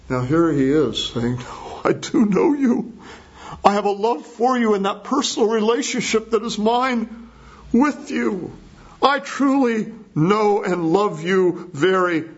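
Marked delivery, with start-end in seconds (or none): 1.4-12.3 emphatic affirmation. Retold or imitated. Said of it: "And so in contrast".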